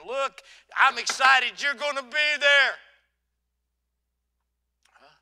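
normal talking voice

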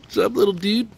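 high, sweet pet talk; cute animal voice. Real, not acted. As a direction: coo at the little animal. Talking to the possum.

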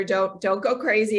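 normal talking voice

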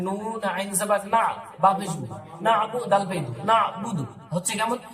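ordinary talk, nothing marked

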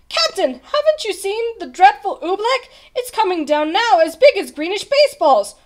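high, loud, urgent report; frantic warning. A narrator's reading. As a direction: warn in excited alarm. Bartholomew frantic to warn captain.

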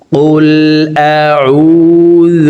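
"أعوذ" long and drawn out, slow; tajweed recitation. Shown as a demonstration. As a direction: stretch the vowel of "أعوذ". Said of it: "আমার সঙ্গে বলে শিখে নিন".

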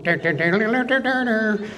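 singing the car horn tune; dun-dun horn melody, voiced; imitating the Dixie horn. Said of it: "Oh, Dixie horns".

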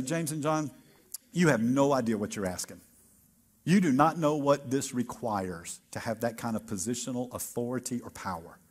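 a calm teacher correcting his students; Jesus, gently. Acted. Preacher voices Jesus' reply.

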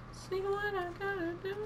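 tiny, very high falsetto echo; Chris Denker. Pretend. Player echoing himself for fun, soft high falsetto. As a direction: squeak it softly in a thin, high voice. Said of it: "I'm sounding a lot like Chris Denker".

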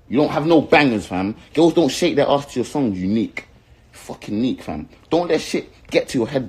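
loud, high-pitched heated tirade; real anger, voice lifted and forceful; angry rant. Real, not acted. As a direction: raise your voice and tear into a rival. Angry rant at rival artist.

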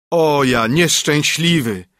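slow, drawn-out wail of woe; groaning lament. A narrator's reading. Carter groans at the ruined wheat harvest, slow and woeful. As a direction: moan it as if all is lost. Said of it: "jęknął nieborak".

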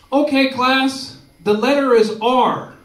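high, loud, slow mock schoolboy presentation; loud class announcement. Johnny standing up before his class, loud announcement. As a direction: announce it big, drawing out the words.

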